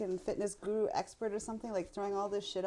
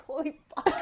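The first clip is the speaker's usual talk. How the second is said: higher, startled curse with a laugh behind it; shocked exclamation; 7 semitones higher, 4 dB louder than usual. Retold or imitated. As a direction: gasp it out like you just got told off. Retelling being told push-up wrong.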